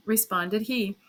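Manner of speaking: ordinary delivery, nothing marked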